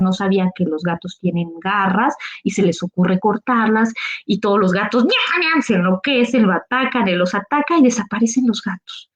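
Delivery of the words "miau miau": high mock meows; cats meowing. Cats react as Juan cuts their claws, high mock meows. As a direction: imitate angry cats, high.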